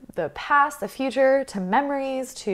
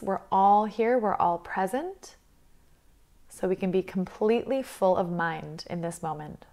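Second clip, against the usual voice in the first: guided meditation; 6 dB quieter than usual. Acted. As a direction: guide them calmly through the breath, leaving space between phrases.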